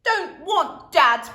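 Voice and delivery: put-on loud slow toddler refusal; bellowing toddler. A narrator's reading. Toddler refusing dad, bellowed. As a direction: bellow like a stubborn baby. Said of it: "bellowed the baby".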